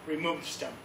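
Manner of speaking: low, soft instruction; understated low command; Jesus's quiet command. Retold or imitated. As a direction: tell them quietly, lightly voiced Jesus. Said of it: "he tells everyone".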